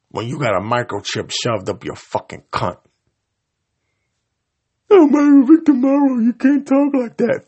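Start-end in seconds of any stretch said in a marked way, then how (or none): 4.9-7.4 high, prim mock scolding; mock a fussy critic tutting at him; mocking prissy voice. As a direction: critic told him off, mocked in a prim falsetto.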